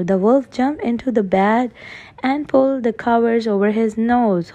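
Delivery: ordinary delivery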